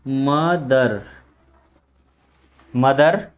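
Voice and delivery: "मदर" pitched low, syllables separated, final र sounded; Hindi pronunciation. Shown as a demonstration. Teacher showing how Hindi says it, "मदर" with r pronounced. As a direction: say "मदर" deliberately with a clear ending r. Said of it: "Hindi में बोलें तो इसको क्या बोलेंगे".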